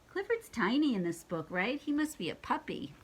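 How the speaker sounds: ordinary delivery, nothing marked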